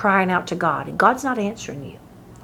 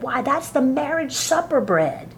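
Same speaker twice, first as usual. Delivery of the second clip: ordinary delivery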